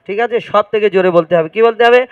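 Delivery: normal talking voice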